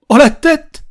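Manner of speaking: high, loud teasing exclamation; delighted mock astonishment; amused, half surprised. A narrator's reading. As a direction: burst out, half joking. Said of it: "s'amusa le vieil homme, mi-surpris, mi-rigolard".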